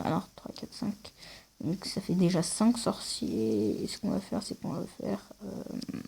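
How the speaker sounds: very quiet, half to himself; muttered counting. Real, not acted. Player counting sorcerers to himself, muttered very quietly.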